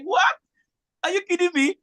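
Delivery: high-pitched acted disbelief; incredulous reaction. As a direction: retell it as an incredulous outburst. Acted reaction to reluctant vendor.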